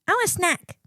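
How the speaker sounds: put-on high-pitched little kid voice; demanding child. Parent imitating daughter wanting a snack, demanding child voice. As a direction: beg like a small child.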